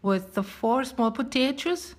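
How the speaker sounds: Giselle. Acted. Brazilian friend shows how to make pão de queijo, Brazilian accent. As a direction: present a recipe in a lively Brazilian accent. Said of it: "tagged me to do the Brazilian accent".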